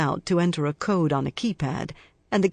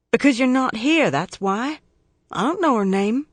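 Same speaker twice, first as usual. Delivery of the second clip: put-on snippy boy, higher and sharper; 8 semitones higher, 3 dB louder than usual. A narrator's reading. Boy on the phone to his aunt, snippy. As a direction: snap back like a sulky child. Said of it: "He scowls, his tone snippy".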